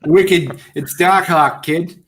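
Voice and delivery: put-on loud, high Boston accent; hammed-up Boston "Dak Hawk". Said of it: "I would still say that in the Boston accent".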